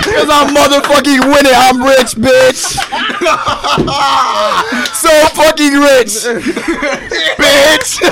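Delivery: gloating shout. Real, not acted. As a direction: yell it out, laughing at them. Gloating about being rich.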